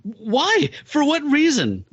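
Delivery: high, hurt and puzzled plea; wounded alien brother. Retold or imitated. Starseed, attacked by the werewolf, asks him why in a high, bewildered voice. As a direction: ask it high and bewildered, feeling betrayed. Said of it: "he says".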